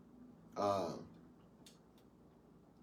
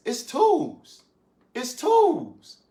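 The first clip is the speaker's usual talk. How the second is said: emphatic; 8 semitones higher, 4 dB louder, much slower than usual. Real, not acted. Preaching emphatic about soulmates.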